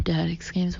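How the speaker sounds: plain reading voice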